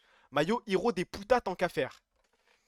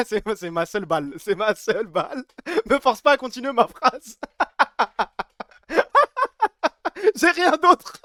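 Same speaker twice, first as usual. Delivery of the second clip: louder, breaking into laughter; laughing; 6 semitones higher, 8 dB louder than usual. Real, not acted. Streamer cracking up at his own joke, laughing and louder. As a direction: laugh through the words.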